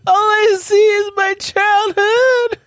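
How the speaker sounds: hammed-up, very high loud cries; mock panicked wailing. Headset wearer unable to see, hammed-up panicked wail. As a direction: wail like a terrified, lost child.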